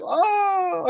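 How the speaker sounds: high breathy oh; startled oh. Retold or imitated. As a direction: gasp it high in shock. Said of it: "they're just like".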